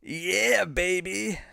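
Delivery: high, bright-pitched hammed-up Austin Powers. Streamer answers chat's request, hammed-up Austin Powers. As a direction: throw out the catchphrase like Austin Powers. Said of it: "can you do Austin Powers say".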